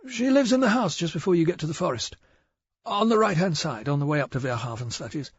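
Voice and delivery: a jittery village shopkeeper eager to please; nervous shopkeeper. Acted. Narrator voices nervous shopkeeper.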